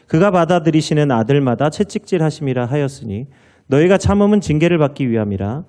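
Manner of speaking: loud, solemn Bible reading; scripture reading. Acted. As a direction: proclaim the scripture to the church.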